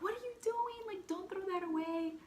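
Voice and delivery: high-pitched imitation of a protesting little sister; shocked protest. Little sister scolding her for tossing the ring, high mock protest.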